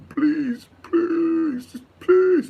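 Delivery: high, whiny pleading please; mock begging. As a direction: beg pathetically, pitch way up. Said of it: "I seem like I'm begging".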